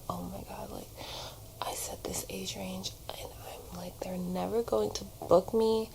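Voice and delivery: retold whisper of worry; anxious whisper. To herself about the casting director, an anxious whisper. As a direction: hiss the fears under your breath. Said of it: "It was my anxiety talking".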